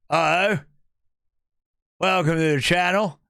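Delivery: talking with the top lip held still; stiff upper lip. Shown as a demonstration. Streamer testing advice from chat, stiff-lipped greeting. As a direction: keep your upper lip frozen as you speak. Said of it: "don't move my top lip".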